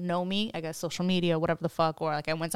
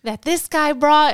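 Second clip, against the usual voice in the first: mock jealous rant; 7 semitones higher, 6 dB louder, slower than usual. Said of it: "So, like, the other guy".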